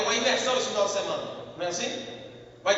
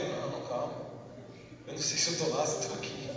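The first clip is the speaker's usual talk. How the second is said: drowsy; 8 semitones lower, 10 dB quieter than usual. Retold or imitated. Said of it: "minha esposa fala".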